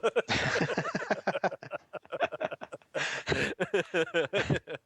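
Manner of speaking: real, sustained chuckling; high run of rolling laughter; long hearty laugh. Real, not acted. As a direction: laugh at your own punchline at length. Laughs at own story's ending.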